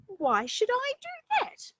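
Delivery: high, loud little bunny voice; a small child bursting to show off her tooth; excited little rabbit. Acted. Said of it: "said Little Rabbit".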